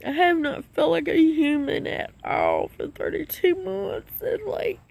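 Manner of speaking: high, tearful, voice catching; crying while thanking life; happy crying. Real, not acted. To her viewers, choked up with joy. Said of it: "I started crying on y'all".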